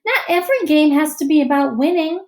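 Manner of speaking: firm classroom moral; teacher's lesson. A narrator's reading. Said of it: "says Missus Beam".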